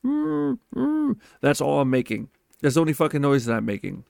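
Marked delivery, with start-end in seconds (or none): 0.0-1.2 strangled high hum through a closed mouth; muffled scream. Retold or imitated. Man frozen in sleep paralysis tries to scream, muffled high hum. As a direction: try to scream but only a trapped high mm comes out. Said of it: "the only thing that's coming out is".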